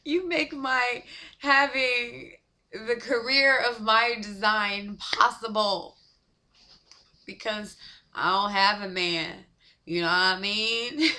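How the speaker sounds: crying through the words, nose running; snotty crying. Real, not acted. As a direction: push the words out through real tears. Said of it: "I, I'm crying".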